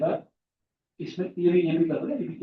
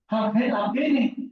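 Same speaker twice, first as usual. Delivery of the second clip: higher, slow questioning imitation; retold surprised query; 6 semitones higher, slower than usual. Sir on the phone asking about a missed class, mock surprise. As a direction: imitate sir's drawn-out surprise.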